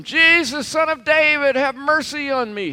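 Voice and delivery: high, loud desperate cry for mercy; beggar calling out. Retold or imitated. Beggar crying out to Jesus.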